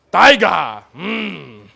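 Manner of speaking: loud mocking repeat of the name; barked name. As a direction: bark the boy's name. Commander barks name.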